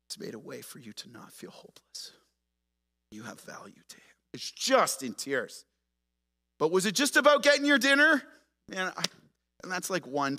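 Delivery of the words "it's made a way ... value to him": soft unvoiced whisper; whispered comfort. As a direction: whisper it gently to someone in tears. Tender words to weeping cashier.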